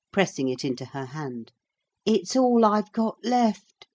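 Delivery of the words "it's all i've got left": usual speaking voice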